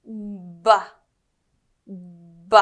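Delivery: short voiced B popped with the lips; isolated B sound. Shown as a demonstration. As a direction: pop the lips for a clean B. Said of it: "Let's try it".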